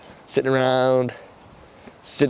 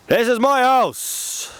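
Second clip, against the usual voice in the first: high, drawn-out mock scream in a Dublin accent; 14 semitones higher, much slower than usual. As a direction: imitate a man screaming, long and high. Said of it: "he screams at the top of his lungs".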